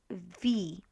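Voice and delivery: "V" said as "vee"; pronunciation demo. Shown as a demonstration. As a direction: Polish W and I blended into "V". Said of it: "W and I together is V".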